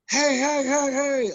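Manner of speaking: high, loud imitation of a cop hailing; retold calling out. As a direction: mimic an urgent high-pitched holler. Cop calls out to driver.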